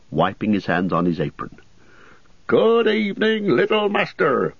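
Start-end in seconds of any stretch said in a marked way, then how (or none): 2.5-4.5 high, bustling landlord voice; a cheery host bending down to greet; jolly innkeeper. A narrator's reading.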